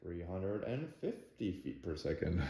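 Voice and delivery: quiet monotone machine reading; half robot voice. Real, not acted. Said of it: "That's enough, [name]. Stop it".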